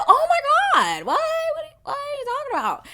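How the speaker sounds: very high mock squeal of disbelief; retold shock in a piercingly high voice; exaggerated shock. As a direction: squeal it, scandalized and over the top. Acts out shock at writer reveal.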